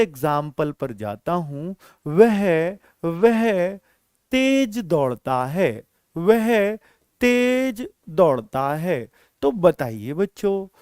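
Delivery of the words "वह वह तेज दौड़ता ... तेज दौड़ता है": word by word with pauses; slow dictation. Shown as a demonstration. Teacher writing a third example, slow dictation. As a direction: dictate it slowly for note-taking.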